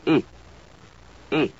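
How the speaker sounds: "E" as French é, short, no diphthong glide; short sharp French E. Shown as a demonstration. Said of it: "It's short and sharp".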